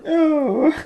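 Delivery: high, loud shocked no; appalled. Real, not acted. Co-host reacting to a gross line, shocked. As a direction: recoil at what you just heard. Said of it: "That's awful".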